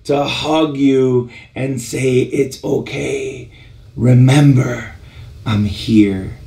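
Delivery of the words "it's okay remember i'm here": low, slow, gentle comfort; tender reassurance. Retold or imitated. God hugging a struggling believer, tender and slow. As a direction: reassure like a loving father.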